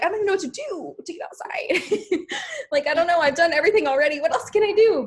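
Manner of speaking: high, loud, laughing exasperation; exasperated inner quote. Retold or imitated. Herself frustrated about getting outside, high laughing exasperation. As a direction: throw up your hands, laughing at yourself. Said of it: "I'm like".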